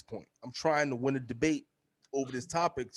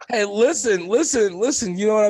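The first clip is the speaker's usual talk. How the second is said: hammed-up outro call; 8 semitones higher, 10 dB louder than usual. Hamming up the show's outro.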